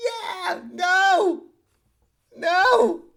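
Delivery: high, loud cheering then refusal; crowd response. Retold or imitated. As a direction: yell back at the speaker. Crowd answers the announcer.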